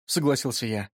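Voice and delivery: usual speaking voice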